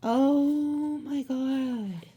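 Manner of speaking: drawn-out slow gasp of wonder; stunned awe. Real, not acted. Reader to viewer, slow stunned oh my God. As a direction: let it stretch out in disbelief.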